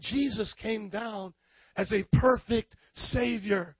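usual speaking voice

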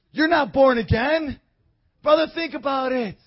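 loud, rising street preaching. Acted. Preacher pressing the man, loud and rising. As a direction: preach it at full force to the man.

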